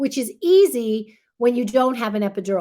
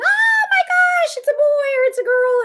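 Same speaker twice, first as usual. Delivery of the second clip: overjoyed cry; 5 dB louder than usual. Retold or imitated. New parent crying out at the birth, overjoyed and much higher. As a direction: shriek it out like you just met your baby.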